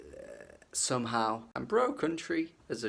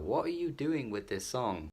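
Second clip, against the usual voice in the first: usual speaking voice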